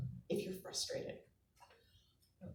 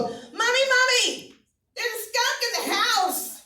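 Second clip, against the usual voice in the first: put-on squeaky kid voice, much higher; little kid voice; 12 semitones higher, 4 dB louder than usual. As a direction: play the small daughter calling her mom. Child quote in stand-up story.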